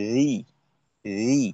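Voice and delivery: রি kept thin and light; thin letter. Shown as a demonstration. Teacher showing the thin letter, light and clear. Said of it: "পাতলা করে উচ্চারণ করব".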